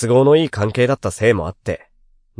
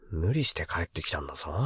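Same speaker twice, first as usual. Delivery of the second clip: soft grumble to an empty room; muttering to himself; 7 semitones lower, 14 dB quieter than usual. Acted. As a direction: mutter it under your breath. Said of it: "誰もいない部屋で独り言が出た". (誰もいない部屋での独り言)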